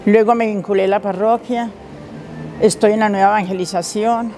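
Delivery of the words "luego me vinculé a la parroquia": usual speaking voice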